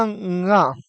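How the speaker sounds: "ङ" as a held ng opening into a; nasal nga. Shown as a demonstration. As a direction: hum ng before releasing "ङ" as nga. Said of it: "इनका उच्चारण कैसे होगा".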